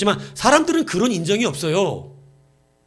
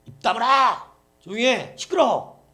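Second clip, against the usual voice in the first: acted irritated hushing, higher pitched; mock-angry bystander; crowd scolding; 5 semitones higher than usual. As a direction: snap at someone to shut up. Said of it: "했더니 막 주변 사람이".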